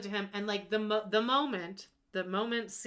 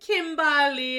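drawn-out flirty name, much louder; flirty name call; 5 semitones higher, 10 dB louder, much slower than usual. Retold or imitated. Host mimicking the husband flirting to calm her, loud drawn-out coo. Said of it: "he does the whole, like".

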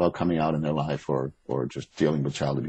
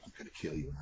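much softer mock threat; under his breath; 3 semitones lower, 18 dB quieter than usual. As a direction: grumble a fake threat to himself. Mock threat to slow shopper.

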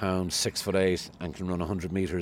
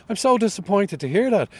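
much higher, louder mock-crestfallen cabbie; disappointed cab driver; 12 semitones higher, 8 dB louder than usual. Cabbie hearing the host slagged, high hurt voice. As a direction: pitch it up high and let him sound let down. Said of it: "He goes".

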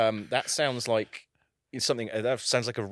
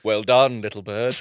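louder, patronising well done; mock praise; 3 semitones lower, 7 dB louder than usual. Partner praising him, patronising mock praise. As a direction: pat them on the head with it. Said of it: "and they come in and go".

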